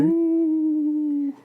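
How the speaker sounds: high ghostly ooh. Pretend. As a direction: wail like a cartoon ghost. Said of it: "I'm a ghost".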